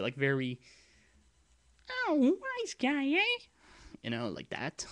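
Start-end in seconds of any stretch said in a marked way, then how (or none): 1.9-3.4 very high, loud, slow stooge voice; Three Stooges. Shown as a demonstration. Showing actors the little bad guy, high comic stooge voice. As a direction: squeal it like a little slapstick henchman. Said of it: "I'm thinking Three Stooges here".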